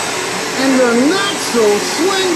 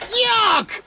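normal talking voice

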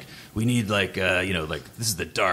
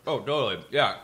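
chewing ice while talking; mouth full; 6 semitones higher than usual. Real, not acted. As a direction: talk around a mouthful of ice. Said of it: "Sorry, I got ice in my mouth".

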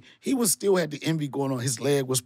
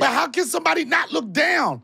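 loud protest; 13 semitones higher, 4 dB louder than usual. Real, not acted. Host protests the dating rules.

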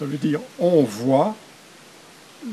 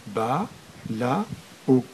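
syllable by syllable; 4 dB quieter, slower than usual. Shown as a demonstration. Teacher breaking a word down for new readers, syllable by syllable. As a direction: split BA, LA, O with a pause after each. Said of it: "tout en décomposant d'abord".

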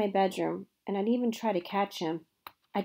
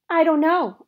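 flustered; 8 semitones higher, 9 dB louder than usual. A narrator's reading. Girl panicking in storm.

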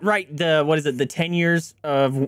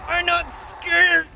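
higher, slow, drawn-out fake courage; mock bravado; 12 semitones higher, much slower than usual. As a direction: pretend to be brave while panicking.

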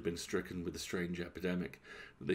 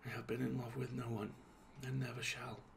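whispered; 5 semitones higher, 7 dB quieter than usual. A narrator's reading. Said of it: "she whispered".

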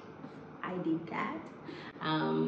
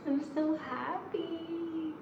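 excited reveal; 12 semitones higher, 5 dB quieter, slower than usual. Real, not acted. Unveiling her new laptop.

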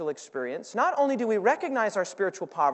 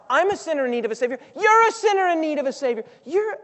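impassioned plea; 7 semitones higher, 6 dB louder than usual. Real, not acted. Impassioned preaching, imagined speech.

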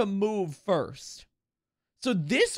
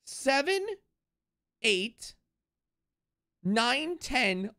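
usual speaking voice

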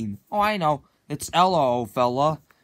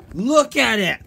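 loud, higher demand; shouted order; 5 semitones higher, 5 dB louder than usual. Acted. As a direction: shout him into looking. Forcing friend to watch movie.

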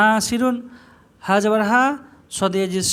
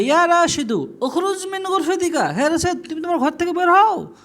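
raised, high-pitched call; calling out an order; 8 semitones higher than usual. Shown as a demonstration. Teacher calls to Rashed to get out of his room, calling out. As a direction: order someone out from across the room.

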